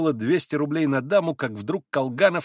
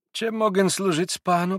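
higher-pitched, polite Polish pan; babbled politeness; 7 semitones higher than usual. A narrator's reading. Little pan asking to serve Mitya, babbled politeness. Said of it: "пролепетал маленький".

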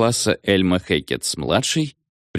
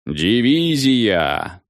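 drawn-out command; 2 semitones higher, much slower than usual. A narrator's reading. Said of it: "закричал командир дивизии в микрофон".

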